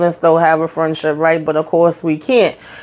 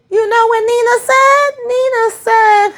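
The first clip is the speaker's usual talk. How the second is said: pitched way up in disbelief; high squeal of disbelief; incredulous buildup. Retold or imitated. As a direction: ask it again, much higher, can't believe it. Repeats the setup, rising.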